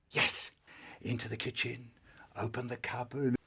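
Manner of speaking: soft, hushed, sneaking; creeping whisper. Acted. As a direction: tiptoe through it in a whisper. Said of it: "so really quiet".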